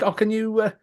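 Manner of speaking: lightly voiced timid request; a hint of an eager tourist asking; mock polite plea for a photo; high polite asking voice. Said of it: "It's like".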